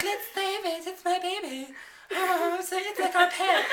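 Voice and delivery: put-on baby-talk it like a new mother; doting woman. Woman begs to keep the creature, high doting voice.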